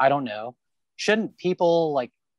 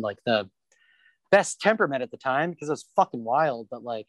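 ordinary talk

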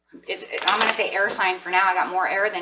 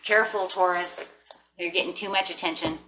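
ordinary delivery

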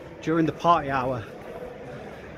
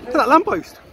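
high, loud mock-impressed exclamation; mock amazement; 9 semitones higher, 7 dB louder than usual. Vlogger pointing out a lamppost to friend, mock amazement. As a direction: exclaim in pretend awe, pitch leaping up.

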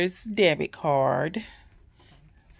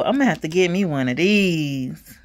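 normal talking voice

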